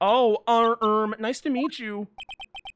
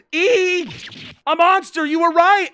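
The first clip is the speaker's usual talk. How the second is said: high, loud hammed-up scream of fright; mock shriek; 8 semitones higher, 8 dB louder, slower than usual. Maya shrieking at the monster in the warehouse. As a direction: squeal like you just saw a monster.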